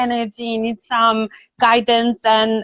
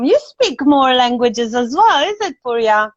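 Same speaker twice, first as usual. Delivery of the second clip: normal talking voice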